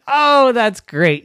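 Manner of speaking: high, loud, drawn-out delight; delighted mother. Acted. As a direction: gush it like a pleased mom. Mommy hugs relieved Daphne.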